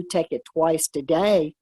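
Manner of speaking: usual speaking voice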